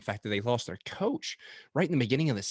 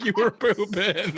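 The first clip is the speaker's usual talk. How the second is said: laughing; 11 semitones higher, slower than usual. Retold or imitated. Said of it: "I'm like".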